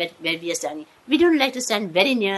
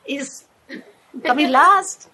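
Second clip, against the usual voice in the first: higher, louder teasing through laughter; 8 semitones higher, 6 dB louder than usual. Retold or imitated. Family teasing her about her horse, high laughing mock. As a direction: rib her playfully about the losing horse. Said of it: "so they all teased me".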